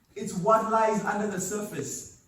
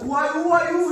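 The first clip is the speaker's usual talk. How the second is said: higher, louder startled question; startled Moses; 9 semitones higher, 10 dB louder than usual. Acted. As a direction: jump at a voice from nowhere. Moses answers the voice.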